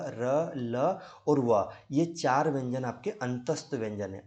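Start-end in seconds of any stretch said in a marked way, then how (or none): 0.1-1.7 र ल व drawn out very slowly, one by one; slow drill. Shown as a demonstration. Teacher spelling out semivowels for students. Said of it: "अंतस्थ व्यंजन आपके य".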